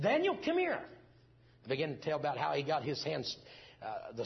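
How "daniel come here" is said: high, loud call across the house. Retold or imitated. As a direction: call the boy in from another room. Calling son into the room.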